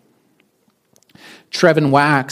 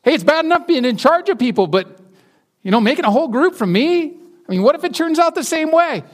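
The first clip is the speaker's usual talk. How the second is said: much higher, fast protesting voice; panicky Moses; 13 semitones higher than usual. Retold or imitated. Moses protesting being made a nation, high fast fluster. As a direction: talk God out of it in a flustered rush. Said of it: "Moses is all of a sudden like".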